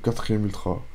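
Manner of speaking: usual speaking voice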